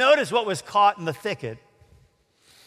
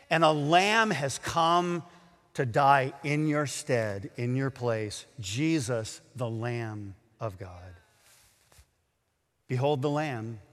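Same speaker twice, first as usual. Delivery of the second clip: slow, lower, softer preaching; slow right down and lower the voice; hushed solemn preaching; 6 semitones lower, 4 dB quieter than usual. Real, not acted. Preacher tells listeners a Lamb died in their place, hushed.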